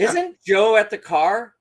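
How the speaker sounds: slow, loud, high retold question; dawning alarm. Son asking dad about Joe, dawning alarm. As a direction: let the worry dawn, drawing it out. Boy realizing Joe is in danger.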